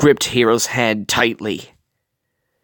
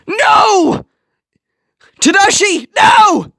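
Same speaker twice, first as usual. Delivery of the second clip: much higher, louder anguished cry; shouting; 6 dB louder, much slower than usual. A narrator's reading. Hiro shouts after his brother running into the fire, anguished cry. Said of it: "Hiro shouted".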